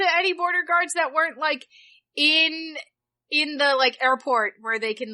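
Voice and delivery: normal talking voice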